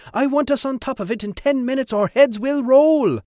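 very high, loud, threatening command; shouted order. A narrator's reading. Trevor threatens the crew to hurry, shouting high. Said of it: "Trevor shouted".

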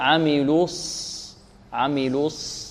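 عملوا الص slow and soft, lips rounded on lu then relaxed for ص; correct lip release. Shown as a demonstration. Teacher correcting students, unrounded ص. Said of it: "ثم نرجع الشفتين في الصاد".

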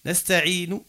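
"نستعين" ending in a short u after N; final short vowel. Shown as a demonstration. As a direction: voice the last N of "نستعين" as "nu". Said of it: "كيفاش توقف عندها؟".

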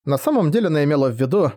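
plain reading voice

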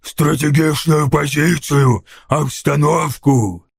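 slow, drawn-out scornful ork echo; dragged-out ork contempt; hissed. A narrator's reading. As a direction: throw the words back slowly as a sneering ork. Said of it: "прошипел Сниггоп".

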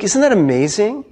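normal talking voice

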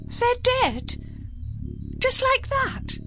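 stunned disbelief. Acted. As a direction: let it sink in, horrified. Polly shocked by the deaths.

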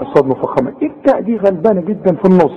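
usual speaking voice

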